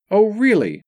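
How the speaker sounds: high, loud scoffing oh really; mocking disbelief; sarcastic. A narrator's reading. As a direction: scoff at her claim. Rob sarcastic retort.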